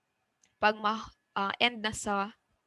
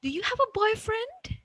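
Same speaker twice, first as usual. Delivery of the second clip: put-on much higher, "boyfriend" left high; rising intonation; 11 semitones higher than usual. Shown as a demonstration. Teacher gives a yes-no example, rising intonation. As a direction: climb steeply and end high on "boyfriend". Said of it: "we have the rising intonation".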